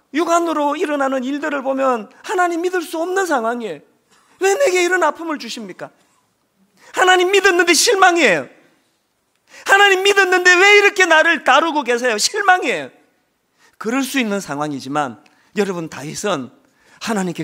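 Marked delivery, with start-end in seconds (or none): 4.4-12.9 loud, high cry of reproach to God; anguished complaint. Acted. David crying out to God in his pain, loud anguished reproach. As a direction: hurl the disappointment upward.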